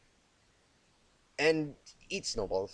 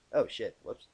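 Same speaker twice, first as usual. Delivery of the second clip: plain reading voice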